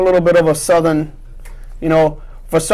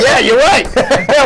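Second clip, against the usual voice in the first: laughing; 7 semitones higher, slower than usual. Real, not acted. Joking about getting a car.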